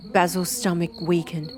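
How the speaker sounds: ordinary delivery, nothing marked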